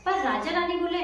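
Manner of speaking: usual speaking voice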